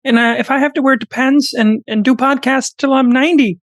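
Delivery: high, pleading lecturer voice held right through; earnestly channel Jordan Peterson defending young men; Jordan Peterson impression. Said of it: "who's better at impressions?".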